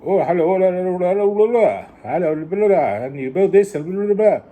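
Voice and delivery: high, loud mocking gibberish; mock broken English. Vlogger mocking an accented tutorial host, loud high gibberish. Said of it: "the language is too broken".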